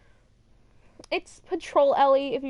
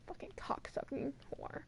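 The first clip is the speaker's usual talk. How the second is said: very soft whispered cursing; whispered insult; 17 dB quieter than usual. Real, not acted. Fan insults Owen after his cheating, whispered cursing. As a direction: curse him under your breath.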